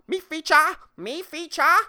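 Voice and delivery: high, loud mock-furious Spanish; angry in Spanish. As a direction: squawk it like an outraged old man. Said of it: "He's just angry".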